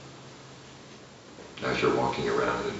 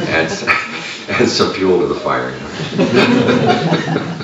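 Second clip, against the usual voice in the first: amused; 6 semitones higher, 7 dB louder, much slower than usual. Real, not acted. Joking with amused audience.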